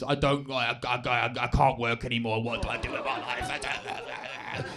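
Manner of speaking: lightly voiced stammering dad, low; a hint of a lost retiree; weird retired dad. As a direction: stumble through it, then trail into a slow, quiet mumble. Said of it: "My dad got weird because".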